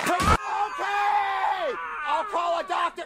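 panicked shout. Acted. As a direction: yell it in a sudden panic.

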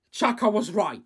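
usual speaking voice

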